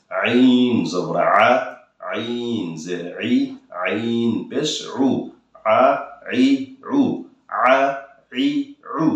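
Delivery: আ ই উ very slow with throat pressure; pressed ain. Shown as a demonstration. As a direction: squeeze আইন from the throat, slowly. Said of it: "চাপ দিবেন গলা থেকে". (গলায় চাপ দিয়ে ধীরে আইন)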